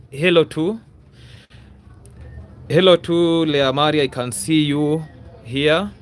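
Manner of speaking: ordinary talk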